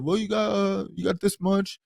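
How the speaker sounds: a lightly voiced online theorist working out the blood-bag radius; theory video voice. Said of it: "one of those kind of videos".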